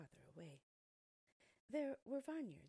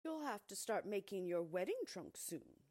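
louder, commanding field mouse; bossy field mouse; 2 semitones higher, 7 dB louder than usual. Acted. Field mouse orders Thumbelina about her wedding, loud and bossy. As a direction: lay down the law to the girl.

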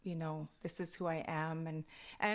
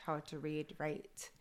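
ordinary talk

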